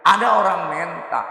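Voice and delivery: ordinary talk, nothing marked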